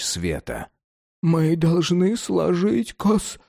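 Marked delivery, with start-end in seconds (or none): 1.2-3.4 high, slow, stammering with cold; teeth chattering. Acted. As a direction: stutter through chattering teeth. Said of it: "стуча зубами".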